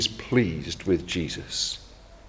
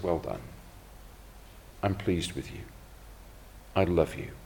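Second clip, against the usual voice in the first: soft, low reassurance from a loving father; the Father encourages Jesus at his baptism, softly and tenderly; tender fatherly encouragement; 4 semitones lower, 9 dB quieter than usual. Acted. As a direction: speak softly to a son you are proud of.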